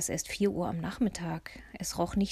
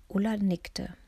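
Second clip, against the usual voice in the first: usual speaking voice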